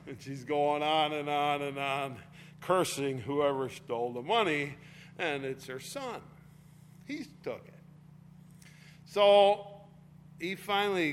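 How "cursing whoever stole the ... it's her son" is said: ordinary talk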